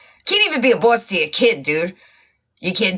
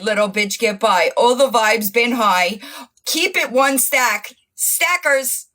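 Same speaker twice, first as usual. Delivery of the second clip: ordinary talk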